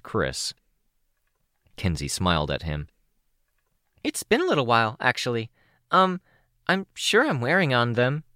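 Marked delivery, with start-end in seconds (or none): 4.0-8.2 put-on high, bubbly teen girl voice; a much higher, chirpy young girl; bright young girl voice, a little shy; Kenzie's voice.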